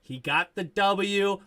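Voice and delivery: normal talking voice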